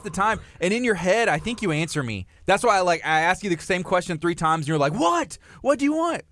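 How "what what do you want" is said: high-pitched irritated snap, imitated; mock bark. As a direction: imitate someone barking back, pitched high. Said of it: "you, like, bark at me".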